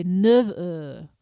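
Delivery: neuf heures, the F of neuf dropped, run together; neuf heures without F. Shown as a demonstration. Teacher showing neuf heures without the F. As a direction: say neuf heures with no F sound before heures. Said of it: "Notice I'm not saying the F".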